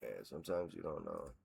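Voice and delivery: half-said thought while reading; muttered aside. Real, not acted. Muttering to himself over stats.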